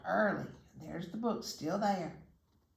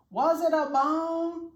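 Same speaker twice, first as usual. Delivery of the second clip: much higher, louder, slow call; 11 semitones higher, 10 dB louder than usual. A narrator's reading. Dog calling up to the vanished bird, loud. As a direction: call up to the tree. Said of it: "He called to the bird".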